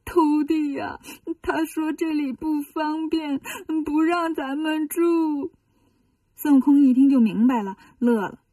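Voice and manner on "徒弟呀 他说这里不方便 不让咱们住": slow, teary complaining; slow tearful whine to his disciples; whiny crying. Acted. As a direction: pout and drag it out through tears. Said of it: "唐僧一撇嘴".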